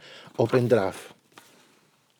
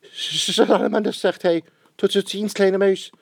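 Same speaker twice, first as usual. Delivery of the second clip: high startled goodbye; panicked snake; 9 semitones higher than usual. Acted. Snake flees from the Gruffalo, high and panicky. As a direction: snake bolts in fright.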